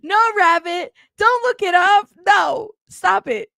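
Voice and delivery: high, loud protest; loud, high-pitched telling-off; mock scolding. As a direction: playfully yell at them to stop. Mock-scolding a viewer looking it up.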